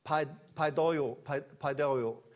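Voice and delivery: paidoio said slowly, syllable by syllable; slow careful Greek pronunciation; Greek word paidoio. Shown as a demonstration. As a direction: spell out paidoio carefully, pai-do-io. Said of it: "Das griechische Wort ist".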